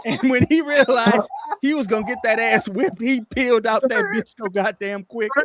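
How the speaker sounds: real laughter through the punchline; laughing. Real, not acted. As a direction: tell it while cracking up. Laughing while telling the ending.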